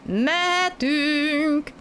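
high, slow drawn-out call. A narrator's reading. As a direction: call forward to the train. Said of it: "kiáltott előre". (elnyújtott kiáltás)

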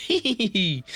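very high hee-hee giggle; acted little squeal of happy laughter. A narrator's reading. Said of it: "boldogan felnevetett, így". (magas, boldog vihogás)

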